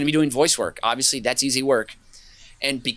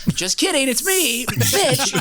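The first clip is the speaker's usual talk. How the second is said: high, laughing gotcha voice; spring the reveal with a cackle; gleeful prank reveal; 11 semitones higher, slower than usual. Pretend. Pranking a partygoer, high laughing gotcha voice. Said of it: "and then you're like".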